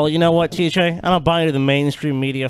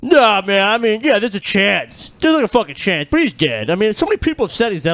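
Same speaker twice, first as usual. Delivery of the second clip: mocking T J; 5 semitones higher than usual. Retelling the restaurant bet, lightly mocking his friend's doubt in a higher voice. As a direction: play the skeptic buddy, lightly mocked and dismissive. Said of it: "And T J's all like".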